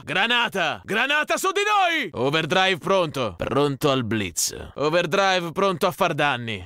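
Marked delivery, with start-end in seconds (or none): 0.8-2.1 urgent warning. Acted. Grenade landing on the squad.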